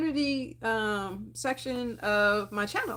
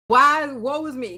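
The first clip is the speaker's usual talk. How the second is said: mock ugly cry; 2 semitones higher, 7 dB louder than usual. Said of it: "get the ugly cry".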